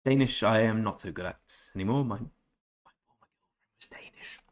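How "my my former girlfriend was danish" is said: whispered aside, breathy and hushed. Real, not acted. Streamer confiding to chat in a whisper. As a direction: drop to a secretive whisper. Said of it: "I whisper".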